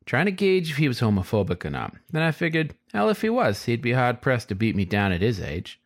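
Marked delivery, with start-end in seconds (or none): none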